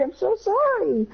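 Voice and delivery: desperate apology. Retold or imitated. Boy begs friend's forgiveness, very high slow desperate plea. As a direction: beg forgiveness in full panic. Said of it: "I said".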